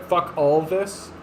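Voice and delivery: "Fuck all this" said slowly, rising at the end; slow emphatic. Shown as a demonstration. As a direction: drag out "Fuck all this", stressing "fuck". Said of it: "is the word that I would use".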